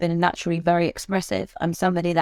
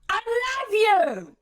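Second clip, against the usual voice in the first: much higher, slow, strained blunt yell; angry, frustrated; slower than usual. Shown as a demonstration. Trainer exaggerating the same words as angry frustration. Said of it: "anger, frustration, blunt directness coming out of my voice".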